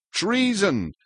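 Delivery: ordinary delivery, nothing marked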